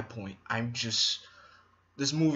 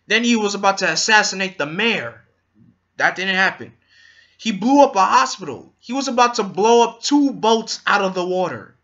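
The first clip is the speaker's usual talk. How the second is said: excited; 6 semitones higher, 9 dB louder than usual. Real, not acted. Excited retelling of Joker's crimes.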